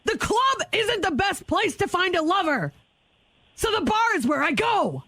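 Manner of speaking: high, barking soldier lyrics; drill sergeant voice. Acted. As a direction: bark song lyrics like orders. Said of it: "Drill Sergeant [name], can you please give us the first lyrics?".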